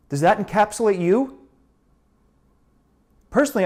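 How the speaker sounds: loud, high-pitched indignant tirade; angry outburst. Acted. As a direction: tear into the kid at full volume. Monologue scolding a presumptuous young man.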